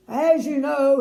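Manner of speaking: loud, grand king's proclamation; royal announcement. A narrator's reading. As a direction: announce it to the whole square like a king. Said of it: "he continued".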